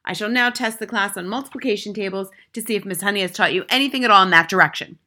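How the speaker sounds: mildly thundered order; thundering. A narrator's reading. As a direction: lay down the law. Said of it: "the headmistress thundered".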